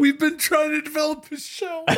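high squeaky voice breaking into laughter; helpless, high-pitched amusement; laughing echo. Real, not acted. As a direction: squeal it through rising giggles. Laughing echo of co-host's point.

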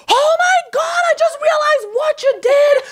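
very high, loud mock panic shriek; freaking-out mom. Mom calling back twenty minutes later, retold as freaking-out shouting. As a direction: imitate a mother shrieking in belated realization. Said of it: "she's freaking out".

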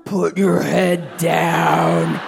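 put-on a child copying a parent's harsh bedtime order; slow harsh command. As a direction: bark it down at the doll. Daughter's real harsh tone.

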